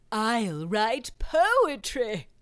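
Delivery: lightly voiced, drawing it out proudly; proud Wilfred. Wilfred announcing his class choice, slow and proud.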